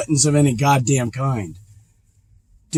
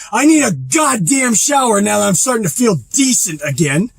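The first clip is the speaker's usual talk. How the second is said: real angry shout, higher and fast-building; yelling his frustration about the shower; furious shouting; 7 semitones higher than usual. Real, not acted. Furious rant about being blocked.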